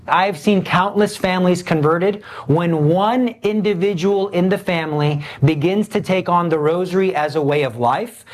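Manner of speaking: usual speaking voice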